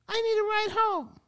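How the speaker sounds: desperate blurt. Retold or imitated. Finally begging the teacher for a ride, high desperate blurt. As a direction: burst out with it, near panic.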